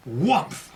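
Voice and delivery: a loud whumph, the pyre catching; big onomatopoeic whumph; explosion sound. A narrator's reading. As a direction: make the burst of flame.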